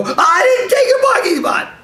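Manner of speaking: very high, loud panicked shout; freaking out. Shown as a demonstration. Wrongly answering an angry guy about parking, freaking out. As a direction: yell it in a hammed-up freak-out. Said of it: "he's freaking out about".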